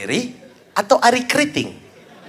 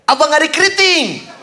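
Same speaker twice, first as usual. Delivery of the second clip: high, drawn-out call; retold yell; 9 semitones higher, slower than usual. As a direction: imitate someone calling out in a club. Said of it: "Ada yang teriak".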